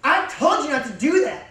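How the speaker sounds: mock angry reprimand; scolding girlfriend. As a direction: snap at him like a bossy girlfriend. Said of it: "a girl will talk to her and be like".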